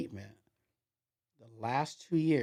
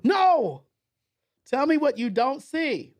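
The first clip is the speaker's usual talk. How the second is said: high, loud and slow, word by word; exasperated insist; 13 semitones higher, 9 dB louder, slower than usual. Retold or imitated. Mentor refusing the answer, slow and loud. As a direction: drag each word out in frustration. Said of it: "He goes".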